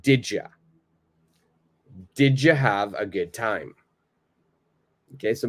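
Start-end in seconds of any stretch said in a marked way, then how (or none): 0.0-3.8 "Did ya" fused as "didja"; reduced speech. Shown as a demonstration. Teacher modelling "Did ya" for repetition. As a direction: say it linked for students to repeat. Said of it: "Just repeat after me".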